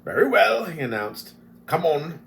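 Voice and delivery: high loud challenge; defiant dwarf. Acted. Flint challenging the goblins, loud and defiant. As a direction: dare them to come.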